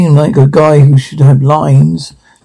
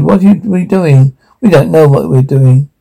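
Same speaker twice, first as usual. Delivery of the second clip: ordinary delivery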